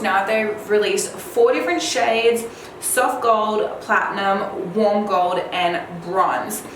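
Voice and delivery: ordinary talk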